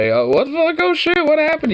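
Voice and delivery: high-pitched retold alarm; mock startled reaction. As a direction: replay your surprise, voice shooting up. Retelling own reaction to angry coworker.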